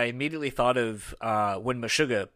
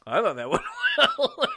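high, laughing mock self-congratulation; smug self-praise; 13 semitones higher, 4 dB louder than usual. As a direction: pat yourself on the back, laughing. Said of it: "and then you're just like".

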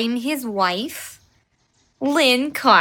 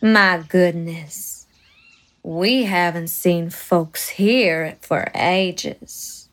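low, slow southern belle voice; southern accent; 9 semitones lower, slower than usual. Acted. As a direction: drawl it like a southern lady. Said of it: "Her southern accent delighted Terry".

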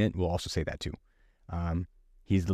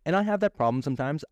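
ordinary talk, nothing marked

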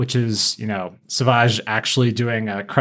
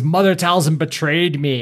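higher, a hint of hurt rage; Savage betrayed; 6 semitones higher than usual. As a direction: spit out the betrayal, pitched up. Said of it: "And he says".

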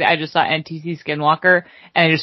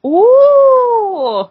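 long, high, loud "ooh"; hammed-up family whoop; excited Minnesotan ooh; 8 dB louder than usual. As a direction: imitate excited relatives with a drawn-out ooh. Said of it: "something that excites my family".

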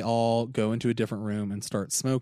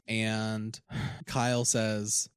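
ordinary talk, nothing marked

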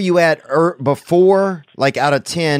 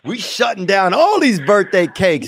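hype man voice; 6 semitones higher than usual. Pretend. Hyping friend, slow far.

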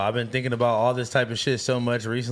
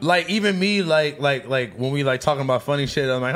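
ordinary talk

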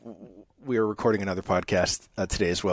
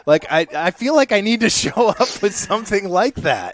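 high, loud amused voice; laughing; 11 semitones higher, 7 dB louder than usual. Real, not acted. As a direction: bubble over with laughter. Laughing over silly opening line.

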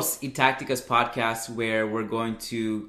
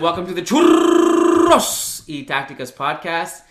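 show intro; 6 semitones higher, 9 dB louder than usual. Acted. Podcast intro welcome.

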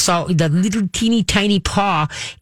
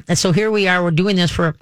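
ordinary talk

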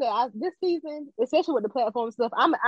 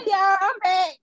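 louder and higher, back on mic; loud cheerful comeback; 8 semitones higher, 4 dB louder, slower than usual. Real, not acted. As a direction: announce yourself brightly.

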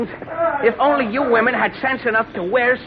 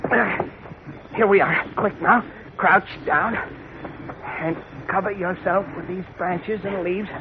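soft urgent hiding voice; hushed; 5 dB quieter than usual. Acted.

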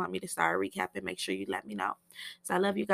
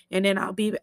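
losing voice; 4 dB louder than usual. Real, not acted. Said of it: "I'm losing my voice".

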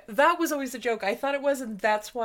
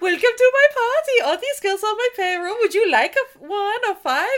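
high, loud, hammed-up party host voice; a smug millionaire showing off his girls; a much higher and louder mock German tycoon; rich German host; 9 semitones higher, 6 dB louder than usual. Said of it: "Rich German guy comes down the steps".

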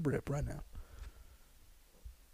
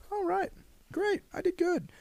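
high, slower, proud little voice; naive pleased performer; 14 semitones higher, 6 dB quieter, slower than usual. Acted. Performer pleased by the applause, naively proud. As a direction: pitch it up, innocently pleased.

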